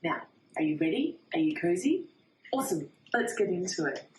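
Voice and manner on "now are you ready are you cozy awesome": ordinary delivery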